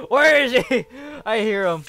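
loud panicked laughing question; nervous laughing panic; scared horror game streamer. Real, not acted.

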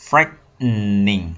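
Frightening drawn out very slowly; slow model word. Shown as a demonstration. Teacher models frightening slowly for the class. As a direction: stretch Frightening out for learners. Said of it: "Frightening mình đã học rồi".

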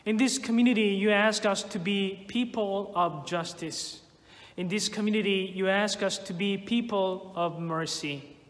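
ordinary talk, nothing marked

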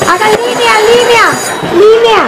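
calling out. Acted. Teacher ordering students into line.

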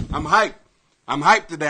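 ordinary talk, nothing marked

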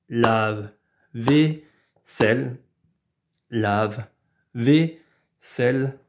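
lave-vaisselle slow, syllable by syllable; robot voice. Shown as a demonstration. (lave-vaisselle en voix de robot, lentement)

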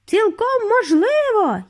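high, slow, breezy reply; buoyant, drawn-out agreement; cheerful. A narrator's reading. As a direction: chirp it brightly, unconcerned. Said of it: "як завжди бадьоро припустив".

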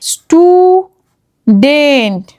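স্টুডেন্ট stretched very slowly; slow demo word. Shown as a demonstration. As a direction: sound out স্টুডেন্ট for kids. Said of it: "phonics demo word". (ধীরে টেনে স্টুডেন্ট)

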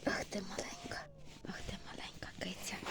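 soft loving whisper. Real, not acted. Owner cooing to a little cat, whispering. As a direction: hush it like a secret to the kitten.

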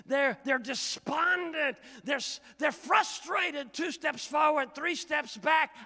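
loud, high, slow fervent preaching; preaching at full force. Real, not acted. Preacher pressing the disciples' despair on the congregation, loud. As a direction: drive it home, loud.

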